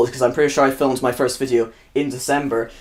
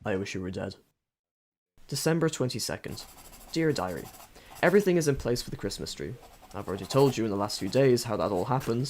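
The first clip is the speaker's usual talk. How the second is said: embarrassed diary reading; 9 dB quieter than usual. Acted. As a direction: quietly read a private diary. Reads diary entries aloud.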